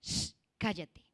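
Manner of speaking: slow, a hint of a scolding grandmother; mild mock grandmother hushing; lightly voiced stern grandmother. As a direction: hush the child like an old grandmother. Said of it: "abuela que no la dejaba hablar y le decía".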